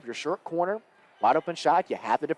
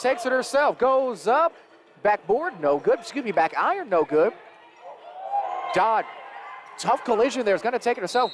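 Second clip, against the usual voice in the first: raised, driving sports call; excited play-by-play; 7 semitones higher, 3 dB louder than usual. Real, not acted. Commentator calling missed shots and rebounds, excited play-by-play. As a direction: call every shot with mounting urgency.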